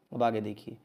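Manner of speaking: ordinary talk, nothing marked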